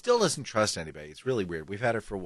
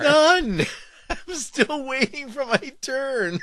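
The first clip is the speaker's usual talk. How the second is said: laughing; 5 dB louder, slower than usual. Real, not acted. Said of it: "I'm dying".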